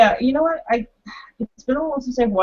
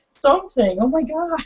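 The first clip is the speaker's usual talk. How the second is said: normal talking voice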